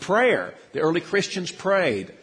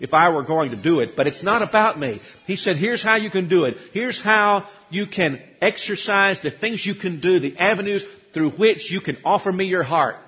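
higher, louder, urgent preacher's voice; preaching at full force; 5 semitones higher, 6 dB louder than usual. Acted. Preacher pressing the congregation about how they worship, loud and urgent. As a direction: press each question home to the pews.

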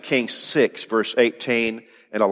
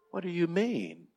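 puzzled servant; 7 semitones higher, 10 dB quieter than usual. Acted. Frightened servant questions Elisha.

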